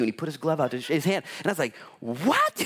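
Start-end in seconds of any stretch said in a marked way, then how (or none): 2.0-2.7 high, comic yelp of disbelief; exaggerated startled question; shocked reaction. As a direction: blurt it high, rising in mock shock. Retells startled reaction to glove handshake.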